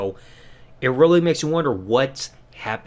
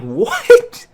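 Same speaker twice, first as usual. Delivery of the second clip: a sudden high, loud cry of disbelief; jaw-dropped yelp; shocked what; 9 dB louder than usual. Retold or imitated. Fan watching the betrayal, shocked high yelp. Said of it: "I was like".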